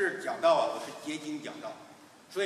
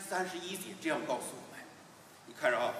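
ordinary talk

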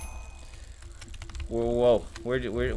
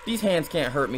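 normal talking voice

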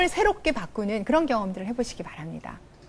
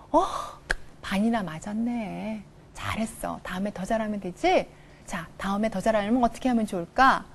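gentle encouragement; 4 semitones lower, 6 dB quieter than usual. Acted. Mother softly reassuring her child about a low test score. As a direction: go easy on the kid, softly.

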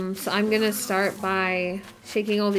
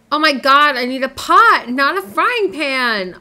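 much louder exasperated cry, last part slow; frustrated outburst; 3 semitones higher, 8 dB louder than usual. Real, not acted. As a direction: groan at the wrong craft out loud. Made the wrong item.

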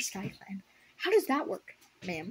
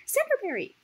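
high, louder yelled name; 4 dB louder than usual. A narrator's reading. Cloudfrost yelling to Cinderberry, high and louder. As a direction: call out the name across the border.

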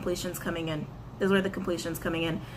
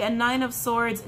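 usual speaking voice

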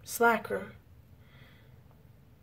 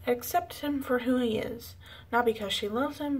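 normal talking voice

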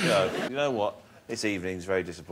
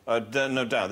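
ordinary delivery

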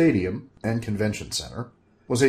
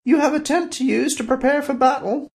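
high, louder sorceress voice; Valerina's voice; 4 dB louder than usual. Acted. Valerina asking Bob, high sorceress voice. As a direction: ask it innocently as the sorceress.

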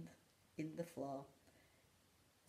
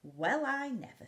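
amused surprise; 7 semitones higher, 8 dB louder than usual. Acted.

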